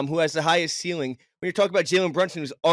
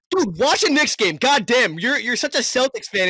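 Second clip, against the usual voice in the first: much higher and louder, fed up with his friend; exasperated outburst; 8 semitones higher, 3 dB louder than usual. Real, not acted. Arguing with a Celtics fan friend about the Knicks, exasperated yelling. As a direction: yell at him to actually watch the games. Heated argument over Knicks.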